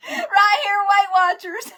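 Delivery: high, loud, through laughter; helpless giggle breaking into the words; laughing. Real, not acted. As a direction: crack up while saying it. Laughing at her own joke.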